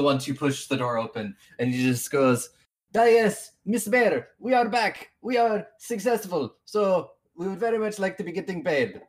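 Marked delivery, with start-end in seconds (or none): 3.0-9.0 high-pitched, put-on character accent; Skull's accent. Skull announcing success to the mayor, put-on accent. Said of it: "I just love doing his accent".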